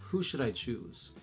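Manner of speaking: usual speaking voice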